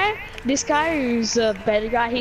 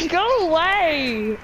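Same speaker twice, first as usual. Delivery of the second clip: laughing shout; 6 semitones higher, much slower than usual. Real, not acted. Fleeing the monster, laughing.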